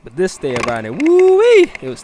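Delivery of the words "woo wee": high, loud woo-wee; excited whoop. Real, not acted. Excited exclamation on bad ride.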